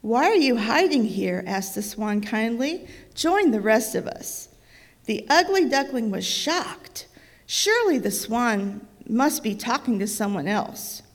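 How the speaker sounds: storybook reading voice, lively; reading to children. A narrator's reading. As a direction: read it aloud like a bedtime book. Reads picture book to child.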